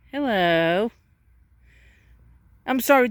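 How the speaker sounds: normal talking voice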